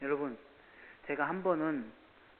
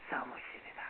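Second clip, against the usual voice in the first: breathy whispered line; 7 dB quieter than usual. Acted. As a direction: drop to a whisper. Whispered end of sermon line.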